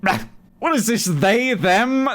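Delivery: mocking a drunk dad who doesn't get it; mock grumpy dad. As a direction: scoff like a baffled old man at pronouns. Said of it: "My dad went".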